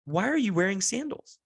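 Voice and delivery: put-on high, questioning little-kid voice; toddler voice. Toddler quizzes dad about his footwear, high childlike voice. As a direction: imitate a small child quizzing a grown-up. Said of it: "two and a half year old kid".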